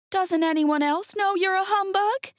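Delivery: put-on high, girlish question. Dorothy questioning the humbug, high and puzzled.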